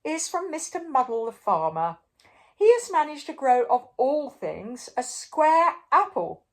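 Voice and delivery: grand king presenting a prize; king announcing. A narrator's reading. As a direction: build up to the square apple. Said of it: "continued the king".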